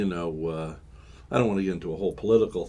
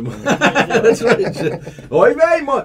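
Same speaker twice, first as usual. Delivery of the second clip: laughing, much higher and louder; 10 dB louder, much slower than usual. Real, not acted. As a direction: laugh through a mock Yiddish exclamation.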